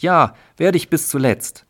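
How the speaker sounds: husband loudly echoing his wife's vow; booming. Acted. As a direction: boom it out grandly. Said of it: "Und das Männchen volltönend".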